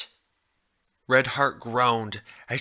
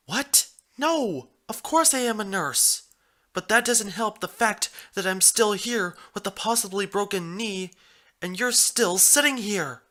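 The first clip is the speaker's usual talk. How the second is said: put-on exasperated young mare; 8 semitones higher than usual.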